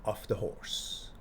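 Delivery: slow whispered book title; reading the title. Retold or imitated. Prime minister reads the inmate's book title, slow whisper. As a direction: hush it out like a big reveal. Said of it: "Opens the book, says".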